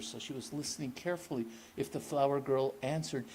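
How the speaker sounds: usual speaking voice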